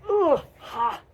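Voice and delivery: high, loud "ugh" and breathy "ha"; venting noises. Shown as a demonstration. Coach shows noises to let it out, venting grunt and huff. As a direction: blow out tension in two bursts. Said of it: "If you need to make a, like".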